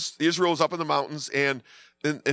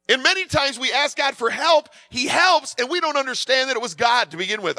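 normal talking voice

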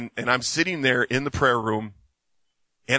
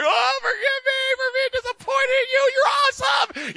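very high, loud crying voice; weeping, high and loud, slowing at the end; bawling plea; 3 dB louder than usual. Retold or imitated. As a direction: sob out the prayer. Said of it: "I just started bawling".

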